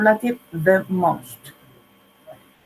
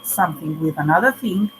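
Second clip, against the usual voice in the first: ordinary delivery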